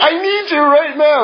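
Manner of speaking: high, urgent plea voicing a baby's cry; high-pitched mock alarm; mock emergency cry. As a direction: act out a newborn's emergency demand, pitched well up. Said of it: "seems like there's an emergency".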